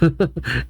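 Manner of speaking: soft, short villain chuckle; quiet mock laughter; acted laugh. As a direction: give a small put-on laugh for the character. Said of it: "Aliana just laughs".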